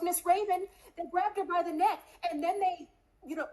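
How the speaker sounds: put-on high, urgent little girl's voice; a frightened child blurting it out; child telling her friend's mum about the attack, high and panicked; scared child.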